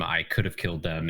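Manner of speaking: ordinary delivery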